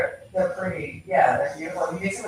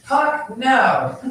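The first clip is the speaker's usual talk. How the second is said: loud, high call of the dog's name; calling from far away; 6 semitones higher, 7 dB louder than usual. Retold or imitated. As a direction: yell the dog's name across the yard. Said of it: "calling him in the yard".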